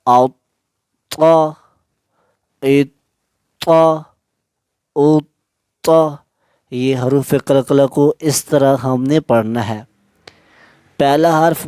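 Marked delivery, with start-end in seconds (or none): 0.1-6.2 each तो pausing then lightly bounced; qalqala stop. Shown as a demonstration. Teacher drilling the qalqala release, measured and clipped. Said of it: "यानी थोड़ा सा रोक के जमा के".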